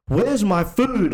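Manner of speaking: high, drawn-out mock demand; angry customer. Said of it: "he was just really angry".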